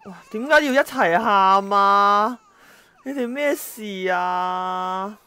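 loud, slow, drawn-out disbelief; exasperated outcry. Real, not acted. Player overwhelmed by both kids crying, loud and slow. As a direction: throw your hands up, loud and slow.

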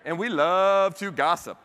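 "love" drawn out high and loud; emphatic stress. Real, not acted. Speaker owning up to gossip culture, "love" stretched emphatically. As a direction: lean hard and long on "love".

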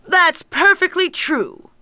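a lightly voiced cruel ogre. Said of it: "said the ogre".

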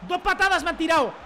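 very high, loud screaming celebration; ecstatic yelling. Real, not acted. Gamer scoring a wild goal, screaming in joy.

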